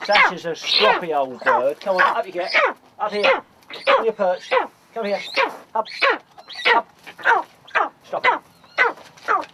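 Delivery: loud, high barked commands to a stubborn bird; firm commands. Real, not acted. Falconer ordering the stroppy eagle onto his perch, loud and firm.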